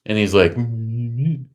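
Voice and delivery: normal talking voice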